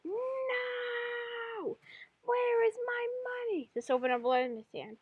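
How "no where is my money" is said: high, drawn-out mock wail; acted despairing cry. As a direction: plead in high-pitched despair. Austin on his knees, despairing.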